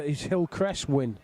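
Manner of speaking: ordinary delivery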